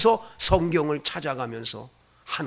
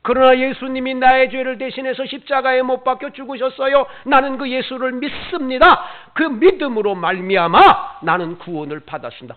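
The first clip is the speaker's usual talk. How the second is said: loud, higher ringing declaration of faith; declared confession; 5 semitones higher, 9 dB louder than usual. Acted. Preacher modelling a confession of faith for the congregation, loud. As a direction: proclaim it as your own creed.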